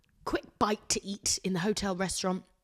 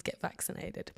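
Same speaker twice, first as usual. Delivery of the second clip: ordinary talk, nothing marked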